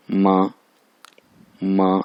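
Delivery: मा held on a level pitch; first tone. Shown as a demonstration. Teacher demonstrating first tone on मा, level tone. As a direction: keep मा even, no rise or fall. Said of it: "इस पे first tone है".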